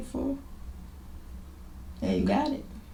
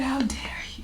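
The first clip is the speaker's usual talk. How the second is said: soft, stern whisper; whispered rebuke; 7 semitones higher, 4 dB quieter, faster than usual. Retold or imitated. God repeating his rebuke, whispered sternly. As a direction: hiss it like a stern father. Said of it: "And he said".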